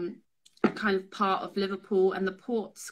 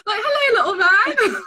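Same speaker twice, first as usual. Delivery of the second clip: much higher, louder Scouse greeting, breaking into laughter; hammed-up Liverpool accent; 10 semitones higher, 9 dB louder, slower than usual. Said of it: "not even gonna do the Liverpool accent".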